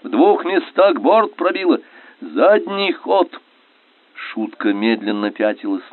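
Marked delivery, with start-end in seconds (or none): 0.0-3.3 high urgent damage report; raised battle shout; shouted cry. A narrator's reading. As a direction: call it out across the boat. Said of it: "крик Болеславского".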